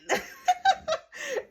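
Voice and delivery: sudden high real laugh; high-pitched real laugh at the story; burst of high laughter. Real, not acted. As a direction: crack up laughing, high and loud. Laughs at her own story.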